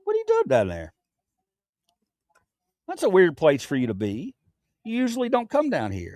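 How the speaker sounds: talking to the dog; lightly voiced pet talk. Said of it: "I started using the dog voice".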